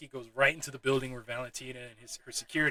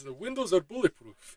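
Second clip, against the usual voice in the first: a boastful Alexei, voice pitched up; lightly voiced Alexei; 7 semitones higher, 7 dB louder than usual. Alexei assuring Walker and Ghost, lightly voiced boast. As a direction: brag about the limo in a put-on voice.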